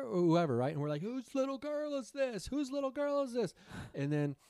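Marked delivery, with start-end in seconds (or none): 1.0-3.5 calling out. Retold or imitated. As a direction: call out to the crowd for the parent. Calling out for parent.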